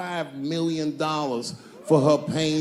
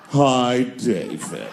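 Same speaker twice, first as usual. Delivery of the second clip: purring drawn-out hi, then a low David; cat-like Stuart; 5 semitones lower, much slower than usual. Retold or imitated. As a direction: sound like a cat that could talk. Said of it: "the way a cat would speak".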